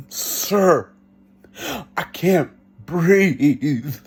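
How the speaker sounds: slow, drawn-out reenacted plea; labored, stretched-out appeal; desperate plea. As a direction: beg the officer, stretching the last word out. Reliving plea from police car.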